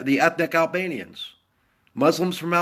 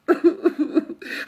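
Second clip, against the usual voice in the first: mocking laugh; 13 semitones higher than usual.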